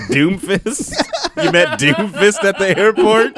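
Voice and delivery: incredulous. Retold or imitated. Incredulous retelling, laugh heard.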